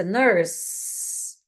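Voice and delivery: ordinary delivery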